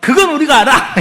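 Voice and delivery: cocky, laughing answer; smug confidence. Acted. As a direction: Pharisees answering Jesus, smug and loud. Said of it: "탁 자신 있게 말합니다".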